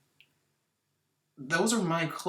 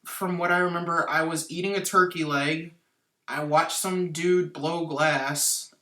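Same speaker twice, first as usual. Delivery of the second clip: normal talking voice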